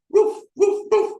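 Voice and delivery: high yappy woof woof imitation; dog barking. Dog in the car barking at the dog food poster, yappy barks. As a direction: bark like a small dog at the poster.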